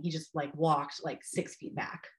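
ordinary delivery, nothing marked

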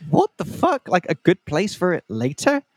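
high, incredulous yelp then questioning; shocked disbelief. Retold or imitated. [name] retelling his stunned reply to the date's hint, incredulous.

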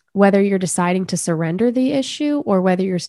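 ordinary talk

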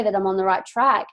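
ordinary talk, nothing marked

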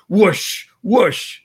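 loud whooshing noise of rushing blood; imitated whoosh. Speaker imitating his heartbeat through a stethoscope, loud whoosh. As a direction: make the sound of blood flowing, breathy and loud.

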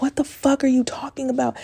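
high-pitched retold disbelief; incredulous. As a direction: challenge a client's wild claim. Incredulous retold reply to client.

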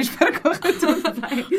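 laughing, high and loud. Real, not acted. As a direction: say it through a laugh. Laughing hopeful wrap-up.

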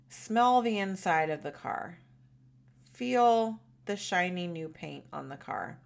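gentle, calm visualization guiding; guided meditation. Shown as a demonstration. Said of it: "a guided meditation and visualization".

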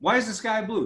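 "Why is the sky blue?" lifted up high. Shown as a demonstration. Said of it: "if I ask you a question like".

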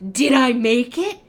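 loud, breathless-with-hope mock question; eager hope. Passenger who ran over to the gate agent, eager hope. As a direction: ask it loudly, desperate for a yes.

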